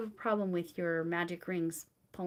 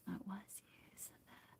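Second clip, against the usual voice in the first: soft whisper while reading; 19 dB quieter than usual. Real, not acted. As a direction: read it under your breath. Reading a chat comment to herself.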